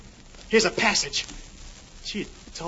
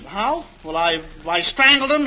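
usual speaking voice

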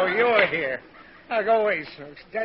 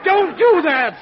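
high, loud, exasperated protest; pained shout; 10 semitones higher, 8 dB louder, slower than usual. Acted. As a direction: shout back in startled agony. Father reacts to yell in ear.

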